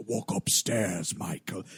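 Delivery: low, slow, menacing drawl; Joker-like villain voice. Retold or imitated. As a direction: tease him like a gleeful villain. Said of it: "Hamill Joker thing".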